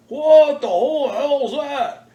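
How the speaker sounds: a man slipping on the floor, gaping at a monster fish; shocked exclamation. Retold or imitated. Weigher gapes at the huge pike, high slow shocked cry.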